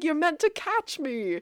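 high teasing quote; imitated flirty high voice; playful Catherine impression. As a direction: voice a coquette being chased, pitched well up. Voices flirty Catherine being chased.

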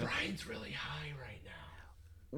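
slow, hushed whisper; whispered aside. Pretend. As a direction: whisper it slowly like a secret. Whispered aside about cohost.

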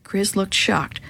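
ordinary delivery

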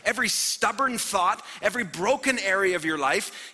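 high, loud preacher's cry; rising sermon fervour; full-force preaching. Acted. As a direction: preach it out to the room with everything.

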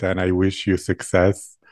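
ordinary talk, nothing marked

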